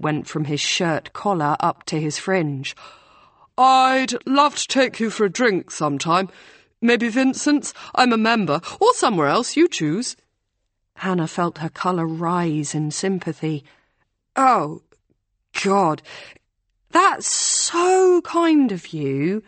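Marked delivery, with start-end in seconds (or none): 3.6-10.2 put-on eager rowing boy. A narrator's reading. Flushed rowing blue inviting Hannah for a drink, eager. Blushing boy asks her out. 14.3-19.4 much higher, slow, strained kindness; awkward politeness. A narrator's reading. Hannah answering the blushing boy, awkward and slow.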